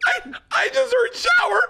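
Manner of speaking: high squeal through real laughter; laughing protest. Real, not acted. Comedian admits his mishearing to co-hosts, squealing with laughter. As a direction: plead it while cracking up. Cracking up at own misunderstanding.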